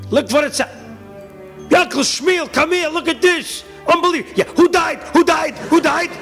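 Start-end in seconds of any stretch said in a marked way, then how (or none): 1.7-4.3 excited townsfolk. Acted. Townsman calling his neighbour over, high and thrilled. 4.5-6.1 high, loud eager questions; eager asking. Acted. Townsman asking his neighbour who died, high and eager.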